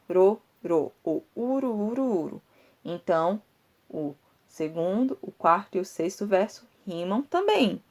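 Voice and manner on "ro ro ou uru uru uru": ro and uru repeated with stress; rhyme sounds stressed. Shown as a demonstration. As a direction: say ro, then uru, leaning on the rhyme. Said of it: "você vê essa questão do ro".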